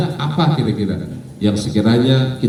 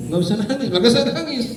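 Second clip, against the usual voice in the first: amused retelling; 11 semitones higher, 3 dB quieter, faster than usual. Retold or imitated. Preacher amused by the retold paradox.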